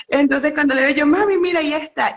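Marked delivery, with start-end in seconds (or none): 1.1-2.1 high, loud thrilled call to mom; a daughter calling her mother over in excitement; excited call. Retold or imitated. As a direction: blurt it out, star-struck. Spotting Shaquille, calling to mom.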